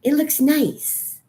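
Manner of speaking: bitter mock cheer; mock sweet. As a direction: coo it like a fake-happy ad. Mock cheery, high, slow.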